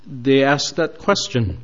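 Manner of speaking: ordinary talk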